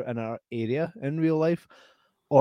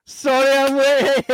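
much higher, loud, slow apologetic greeting; playing a late guest; 13 semitones higher, 9 dB louder, slower than usual. Janice arriving late to the podcast, high and loud. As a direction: burst in as the late friend. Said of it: "we were just talking about you and Ray".

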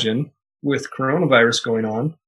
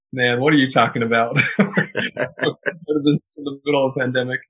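mock incredulity breaking into a laugh; laughing disbelief. Objector reacting to starting a business in a pandemic, laughing disbelief. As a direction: scoff and laugh through the words.

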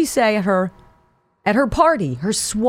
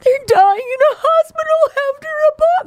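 much higher, overwrought mock wail; mock Rashida Tlaib; 4 dB louder than usual. Host mocking the congresswoman's claim with a high, hammed-up wail. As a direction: parody her as melodramatic. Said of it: "So Tlaib is saying".

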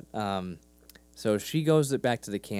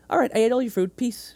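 put-on high, louder, breezy kid's goodbye; little girl signing off; 12 semitones higher, 6 dB louder than usual. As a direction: imitate a child abruptly taking her leave. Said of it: "she goes".

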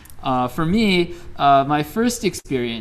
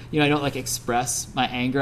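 ordinary talk

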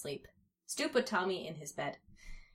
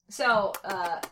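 usual speaking voice

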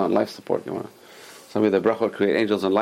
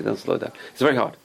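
normal talking voice

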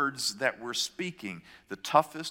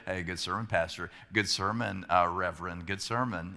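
lower, softer mock praise from the sons; teasing sons; 7 semitones lower, 4 dB quieter than usual. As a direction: sons kidding their pastor dad after church. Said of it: "halfway serious and most of the time kidding around".

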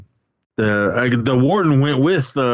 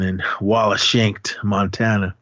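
ordinary talk, nothing marked

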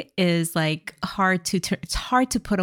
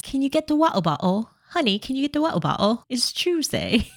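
put-on spoof a British accent, stretching the last word; 4 semitones higher, faster than usual. Partner asking honey for water, mock British accent. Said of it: "which British accent is that".

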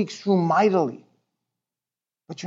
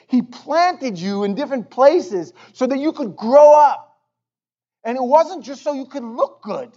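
raised pitch, slow emphatic sermon delivery; impassioned preaching; 5 semitones higher than usual. Real, not acted. Pastor challenging congregation to bear fruit, impassioned preaching. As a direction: press each phrase home, with long pauses.

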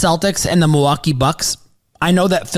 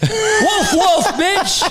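hammed-up, much higher New York tough-guy accent; mock New York accent; 11 semitones higher, slower than usual. Hyping the Knicks, in a mock New York accent. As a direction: ham up a New York trash-talker, pitched way up. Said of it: "I'm gonna start talking in my New York accent".

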